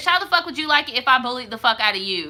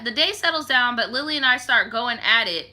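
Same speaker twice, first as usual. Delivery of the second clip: ordinary talk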